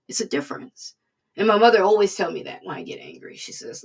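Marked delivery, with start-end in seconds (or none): none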